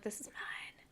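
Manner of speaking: unvoiced whisper claiming territory; ghostly whisper. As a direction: hiss it like a ghost. Grandma ghost voiced.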